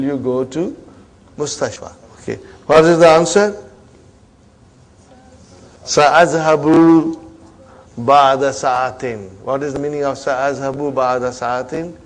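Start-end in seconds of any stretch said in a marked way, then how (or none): 5.9-9.3 slow model reading. Shown as a demonstration. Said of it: "teacher modelling the sentence".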